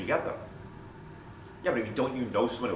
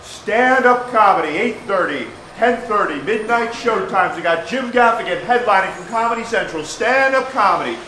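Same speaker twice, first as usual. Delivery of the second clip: loud, higher hawking call; street barker; 6 semitones higher, 6 dB louder than usual. Shown as a demonstration. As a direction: bark the pitch at passing tourists. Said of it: "I start barking".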